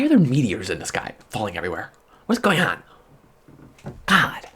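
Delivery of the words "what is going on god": panicked. Real, not acted. Panicked rant about meteors.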